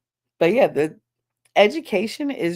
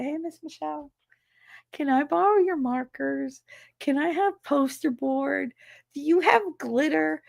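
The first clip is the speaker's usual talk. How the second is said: put-on higher, wheedling kid voice; sweet kid asking; 7 semitones higher than usual. Students asking the teacher for supplies, high and wheedling. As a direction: ask nicely for markers like a hopeful kid.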